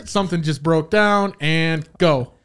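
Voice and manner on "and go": normal talking voice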